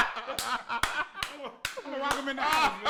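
words squeezed out between laughs; laughing at his own joke; laughing through it. Real, not acted. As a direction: still cracking up while repeating the line. Repeats joke while laughing.

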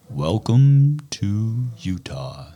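slow, put-on radio DJ voice; radio announcer voice. A nervous podcaster putting on a fake announcer voice. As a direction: stretch it out like a radio host. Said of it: "What are you, Casey Kasem?".

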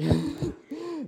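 short laugh. Real, not acted. Preacher laughs at the volunteer's yell.

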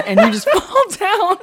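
high squealing laugh through the words; can't get it out for laughing. Real, not acted. Laughing while retelling the slap.

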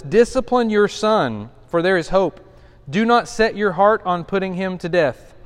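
measured pulpit reading; scripture reading. Acted. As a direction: read the verse with weight. Reads scripture aloud.

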